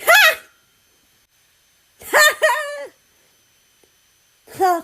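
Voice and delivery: high, loud cackling laugh; laughing at the slip in the group chat; loud burst of laughter. Acted. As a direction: break up laughing.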